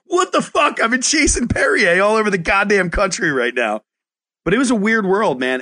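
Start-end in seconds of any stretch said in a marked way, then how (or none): 0.1-3.8 much higher, exasperated what the fuck; retold, flabbergasted exclamation; exasperated outburst. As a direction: blurt it out in shocked frustration. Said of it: "And I'm like".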